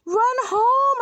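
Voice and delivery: high, loud holler; excited high-pitched team shout; urgent shout. A narrator's reading. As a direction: urge the runner on loudly. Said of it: "shouted the boys".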